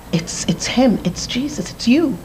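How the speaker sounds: breathless whisper of recognition; barely voiced, hushed in awe; awed whisper. Seeing Jesus, awed whisper.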